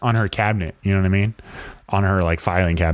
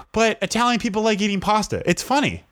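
high, prissy mimicry of the teacher, explaining pasta jokes; mocking teacher voice; 11 semitones higher than usual. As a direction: lift into a high, sing-song imitation of a teacher. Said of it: "She's like".

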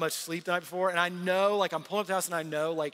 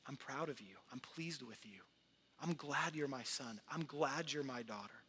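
tender voice of God; 3 semitones lower, 8 dB quieter than usual. Acted. Father speaking to his child, soft and tender. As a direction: lean in close and say it softly. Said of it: "He looks at you and he says".

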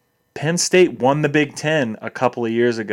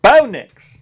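loud, high exclamation; mock-fan holler; 10 semitones higher, 7 dB louder than usual. Hyping the recruit as a mock SEC fan, hollered. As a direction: holler the name like a rowdy fan.

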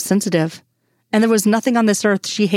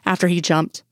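ordinary delivery, nothing marked

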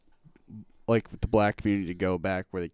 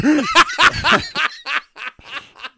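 high laugh; 5 dB louder than usual. Real, not acted. Laughs after impression.

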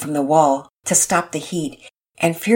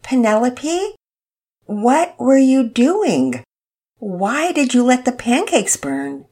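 higher, slow, carefully controlled voice; measured tones; 7 semitones higher, slower than usual. Acted. Mama Peters questions Penelope, slow and measured. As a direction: hold back the irritation, each word deliberate. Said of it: "tried speaking in measured tones".

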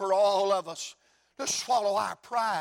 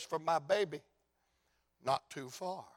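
much softer and lower, choked with tears; tearful father; 5 semitones lower, 8 dB quieter than usual. Acted. Grieving father tells the crowd about his baby, tearful and hushed. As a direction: say it through tears, barely audible. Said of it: "as the tears run down his face".

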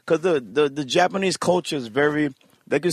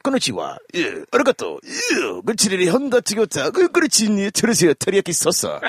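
higher, fast mock Japanese babble; mock Japanese gibberish, higher and fast; 7 semitones higher, much faster than usual. Shown as a demonstration. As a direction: rattle off fake Japanese words, hammed-up. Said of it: "I'm just giving you an example".

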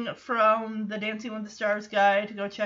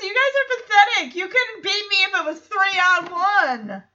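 higher-pitched retold gloating; mock taunting; 6 semitones higher, 4 dB louder than usual. Host acting out Joey taunting the others, mock gloating. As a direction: taunt them in a raised voice.

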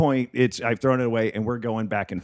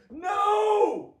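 high, drawn-out mock yell; drunk heckler's yell. Drunk Australian heckler yells at the comic, high mock yell. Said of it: "he yells something out".

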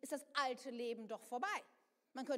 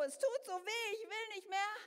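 mild mock cry; 5 semitones higher, 3 dB louder than usual. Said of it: "dann schreit dein Ego".

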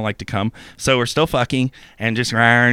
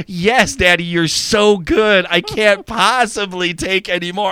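high, drawn-out fake flattery; mock satisfied; 6 semitones higher than usual. Imitating partner eager for video games, mock satisfied. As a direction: imitate a lover gushing to get away.

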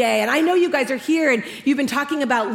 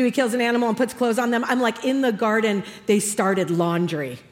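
normal talking voice

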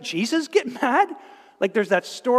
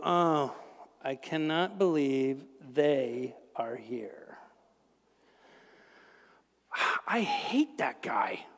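low, slow, hushed muttering under the breath; resentful mutter; 7 semitones lower, 8 dB quieter, slower than usual. Retold or imitated. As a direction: grumble to yourself as the guest walks in, half whispered. Inner grumble at a disliked guest.

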